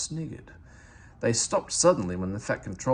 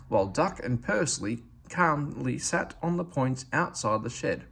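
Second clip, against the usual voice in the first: ordinary delivery, nothing marked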